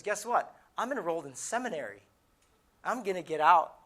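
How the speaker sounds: excited announcement. Retold or imitated. Friend sharing his seminary plans, excitedly. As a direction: announce big news, punching it out.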